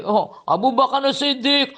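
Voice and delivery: ordinary talk, nothing marked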